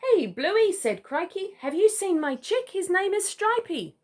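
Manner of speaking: a little emu dad searching for his chick; eager emu dad. Acted. Crikey the emu calls out.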